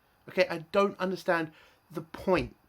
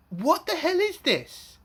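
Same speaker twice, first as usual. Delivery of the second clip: exasperated disbelief; 10 semitones higher, slower than usual. Real, not acted. Exasperated rant at the episode.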